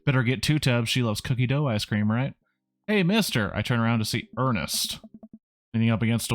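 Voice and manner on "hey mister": put-on kid calling out. Ernest calls out to the dad.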